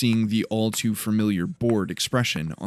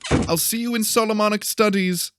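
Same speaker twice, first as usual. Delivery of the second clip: high, loud call through a door; shouted; 12 semitones higher, 3 dB louder than usual. A narrator's reading. Keen yelling back at Corvale while running away, high shout. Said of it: "Keen shouted through the thick door".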